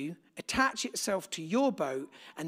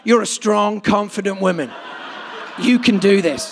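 loud, emphatic self-affirmation; playful loud motivational voice; mock pep talk; 3 semitones higher, 13 dB louder than usual. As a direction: pump yourself up, hammed-up. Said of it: "play messages to yourself".

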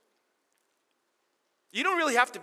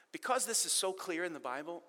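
ordinary talk

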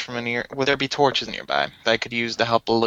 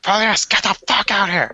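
whisper yelling; 9 semitones higher than usual. Retold or imitated. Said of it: "Yeah, whisper yell".

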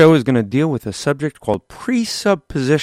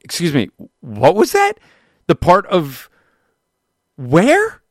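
mock indignation; 4 semitones higher than usual. Mock offense at the Jersey jab.

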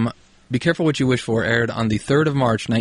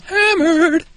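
dramatic hype; 6 dB louder than usual. Pretend. Hyping up the drunk episode.

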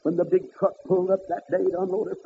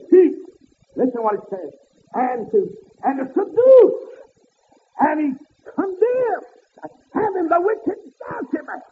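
high, loud and drawn out; slow whooping preaching; 8 semitones higher, 7 dB louder, slower than usual. Acted. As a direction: stretch every word like a whooping preacher.

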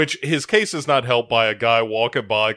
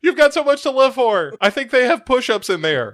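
mock coaxing sergeant; 13 semitones higher, 4 dB louder than usual.

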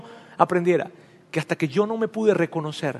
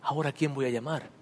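hushed tearful prayer to God, much softer; a soft broken plea; 4 semitones lower, 12 dB quieter than usual. Retold or imitated. Praying to God after losing his parents, tearfully. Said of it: "con lágrimas le dije".

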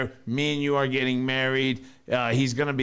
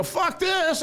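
much higher spooked outburst; retold panic. Storyteller reliving fleeing the Ouija board, high spooked outburst. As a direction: blurt it out in fright. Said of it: "and I go".

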